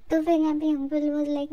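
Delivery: ordinary talk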